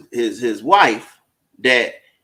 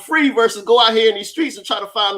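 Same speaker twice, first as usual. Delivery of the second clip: incredulous; 8 semitones higher than usual. Retold or imitated. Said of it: "I said".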